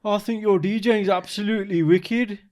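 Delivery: slow, high-pitched put-on Brummie accent; Birmingham accent. Showing the American guest an unlovely accent, Birmingham accent. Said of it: "Right, Birmingham".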